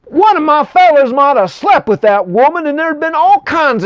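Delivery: king scolding Isaac, high-pitched and indignant. Retold or imitated. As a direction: tear into him like a furious old king. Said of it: "He says".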